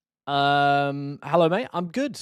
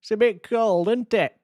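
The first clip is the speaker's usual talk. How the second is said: higher, hammed-up Yorkshire accent; mock Yorkshire accent; 7 semitones higher, 3 dB louder than usual. Yorkshire streamer mocking his own accent to chat. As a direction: lay the Yorkshire accent on thick for fun. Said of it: "That's how we all speak".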